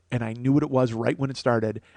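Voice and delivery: ordinary talk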